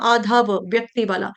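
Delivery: ordinary delivery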